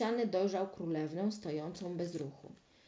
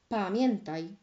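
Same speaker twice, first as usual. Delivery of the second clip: plain reading voice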